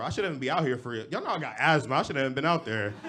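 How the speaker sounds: rushed mock panic; panicked excuse. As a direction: plead your way off the court. Panicked excuse mid-game.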